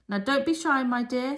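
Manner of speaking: high, loud and beaming. A narrator's reading. Miss Cackle coaxing shy Mildred: high loud beaming voice. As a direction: coax her kindly.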